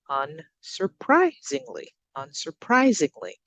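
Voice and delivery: careful teacher's demo of un-sur-PRI-singly; stress on third syllable. Shown as a demonstration. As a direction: lean on PRI in unsurprisingly. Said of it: "we're going up on that third syllable".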